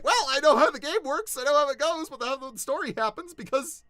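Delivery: high, loud and fast with delight; bursting with giddy pride; gleeful excitement. Real, not acted. Telling fans his character is everywhere, high and loud with glee.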